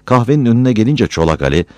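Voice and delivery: ordinary delivery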